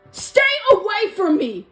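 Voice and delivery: high, loud desperate warning; fleeing queen shouting a warning; frightened warning. Acted. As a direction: push the crowd back with your voice. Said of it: "Elsa warned her subjects".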